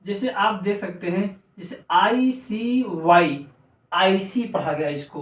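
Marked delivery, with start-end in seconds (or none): 1.9-4.4 slow, letter by letter ICY; spelling out. Shown as a demonstration. Teacher spelling an example word, slow letters. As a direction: spell each letter separately and slowly.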